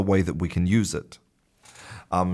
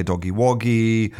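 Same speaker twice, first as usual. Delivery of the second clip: put-on baby talk; 3 semitones higher than usual. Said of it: "when mothers say".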